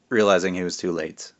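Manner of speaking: ordinary delivery, nothing marked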